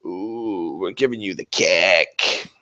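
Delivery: low, soft, slow leering voice; creepy mock gloat. Demon players taunting opponents: low leering creep voice. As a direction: leer it out like a creep. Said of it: "we're like".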